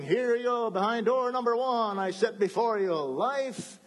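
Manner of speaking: God offers the doors like a game show host. Acted. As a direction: present the prizes like a game show. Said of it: "here's God saying".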